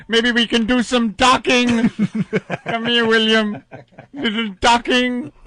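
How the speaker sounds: campy shrieking melodramatic ham; hammy stage actor. Pretend. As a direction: overact every line like a coward. Continuing Doctor Smith parody.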